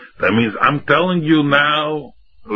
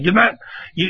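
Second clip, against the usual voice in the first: ordinary delivery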